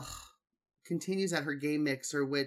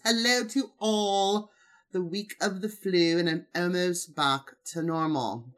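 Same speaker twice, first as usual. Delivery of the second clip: put-on horrible British accent; 2 semitones higher, 4 dB louder than usual. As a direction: read the greeting in a bad posh British accent. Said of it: "My horrible British accent".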